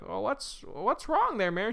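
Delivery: put-on high-pitched old neighbor voice; old man. Old man on a bench asking Mary, high voice. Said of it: "an old man sitting on his bench".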